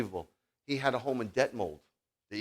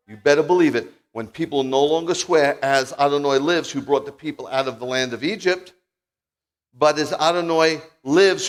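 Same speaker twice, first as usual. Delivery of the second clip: preached scripture reading; 6 semitones higher, 11 dB louder than usual. Acted. Reads prophecy to congregation.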